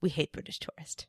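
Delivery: hushed imitation of students; soft, voiceless aside in a student's role; acted whisper. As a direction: whisper it like a shared grudge. Acting out students' dislike of tourists.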